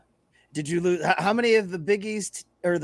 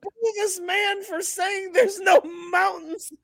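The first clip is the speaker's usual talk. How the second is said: mock outrage; 10 semitones higher, slower than usual. Mock crowd call.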